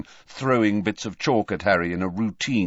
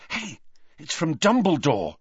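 excitedly; 7 semitones higher than usual. Acted. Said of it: "said Harry excitedly".

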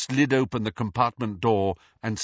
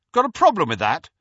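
loud, high challenge; brash boyish challenge; confrontational; 8 semitones higher, 8 dB louder, faster than usual. A narrator's reading. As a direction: round on him, daring an answer. James turns on Snape.

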